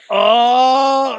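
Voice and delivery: high, loud mock wail of delight; hammed-up loud high cry of delight. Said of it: "He goes".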